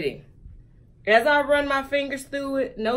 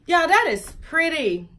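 ordinary talk, nothing marked

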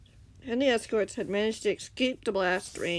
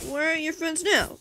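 higher, sharp angry challenge; demanding; 9 semitones higher, 4 dB louder than usual. A narrator's reading. Angry fighter confronts the villain, demanding. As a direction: demand an answer from the enemy. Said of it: "Tango demanded".

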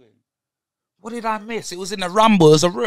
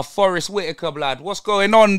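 ordinary talk

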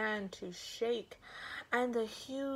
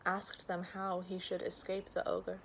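ordinary delivery

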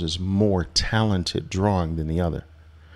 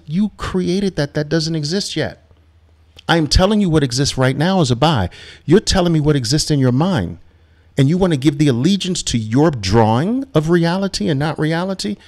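higher, insistent and louder; firm coach; 7 semitones higher, 3 dB louder than usual. Retold or imitated. Coach challenging trader's imagined fear, higher insistent push. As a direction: set the worried trader straight. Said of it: "I'm like".

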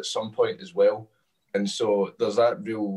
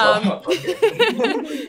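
surprised laugh; 7 dB louder, much slower than usual. Real, not acted.

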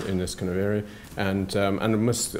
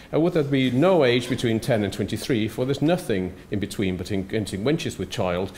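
reciting Shakespeare; 3 semitones higher, 3 dB louder than usual. Retold or imitated. Lecturer reading the Shakespeare quote to the audience, lightly recited. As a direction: lift into the verse on the first line and let it settle. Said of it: "I've got Shakespeare up there".

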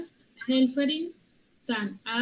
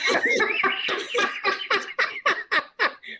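laugh; 9 semitones higher, 3 dB louder than usual. Real, not acted. Laughing at the word.

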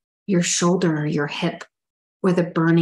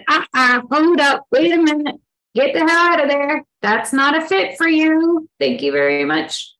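sassy pushback; 8 semitones higher than usual. Pretend. Body's voice pushing back.

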